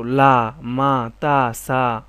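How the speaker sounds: syllable drill for beginners; sounding out syllables. Shown as a demonstration. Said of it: "teacher sounds out syllables".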